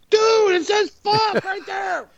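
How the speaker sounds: very high, drawn-out falsetto yell; mock alarmed shout. Imagined TV crew spotting the rude shirt, mock alarmed shout. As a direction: play a panicked crew member in squeaky falsetto.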